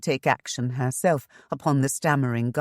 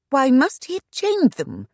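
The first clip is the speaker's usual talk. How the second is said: sharp, high indignant snap; snapped; 12 semitones higher, 5 dB louder than usual. A narrator's reading. Shanna snapping at the agent, sharp and indignant. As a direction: snap the question at him, high and loud. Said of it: "Shanna snapped".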